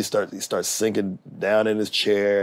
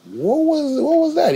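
much higher, incredulous question; bewildered asking. Retold or imitated. Asking the laughing star what just happened, incredulous. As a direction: squeak it out in disbelief. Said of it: "I said".

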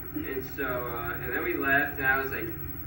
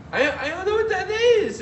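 much higher, mock feminine customer-service voice; woman-like guy; 7 dB louder than usual. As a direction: squeak it up like the agent he took for a woman. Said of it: "this guy who I thought was a woman".

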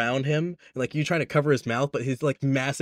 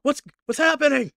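much higher, fast, alarmed questions; startled snail voice; 10 semitones higher, 5 dB louder than usual. Acted. The dropped transponder snail squawking in alarm. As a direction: blurt it out in sudden confusion.

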